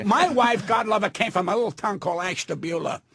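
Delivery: ordinary talk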